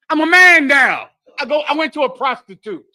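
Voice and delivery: put-on loud proud teen voice; boastful shout. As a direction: shout it like a cocky kid. Voicing boastful youth.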